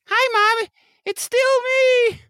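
put-on high boyish voice in a grown man; thirteen-year-old boy voice. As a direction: squeak it out like a young teen boy. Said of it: "with the voice of a, a thirteen-year-old boy".

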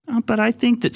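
high, whiny parody of an opponent; whiny strawman voice; mocking whine. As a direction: sneer at their objection in a silly voice.

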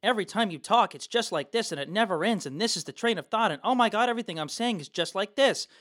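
relentless train of thought, never winding down; nonstop high energy. Shown as a demonstration. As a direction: keep every phrase at the same high peak. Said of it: "it's all high energy all the way".

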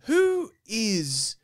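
high puzzled voice; mildly puzzled girlfriend's father voice; quoting Chris. Retold or imitated. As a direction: imitate a baffled dad asking. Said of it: "And Chris was like".

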